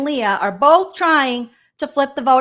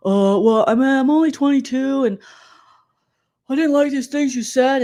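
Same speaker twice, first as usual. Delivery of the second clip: loud, higher whiny protest; mock whiny excuse; 4 semitones higher, 4 dB louder than usual. Recapper imitating housemate defending herself, mock whiny. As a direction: put on a dopey, defensive whine.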